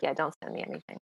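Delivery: a low, soft "don't send me anything"; low muttered refusal. Retold or imitated. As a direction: wave it away under the breath. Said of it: "And he's like".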